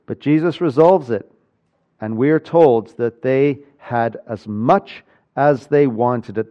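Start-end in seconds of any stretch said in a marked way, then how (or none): none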